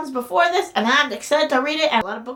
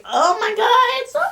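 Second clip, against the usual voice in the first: high, loud, rising squeal; overwhelmed squeal; 10 semitones higher, 5 dB louder than usual. Real, not acted. Gushing over the book's design, overwhelmed squeal. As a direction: let delight overflow into a squeal.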